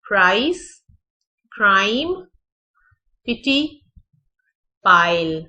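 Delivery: पिटी with a short i, the others with an ai glide; प्राइस, क्राइम and पाइल gliding ai; odd-one-out word test read aloud; careful word demo. Shown as a demonstration. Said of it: "Pick the odd sound out".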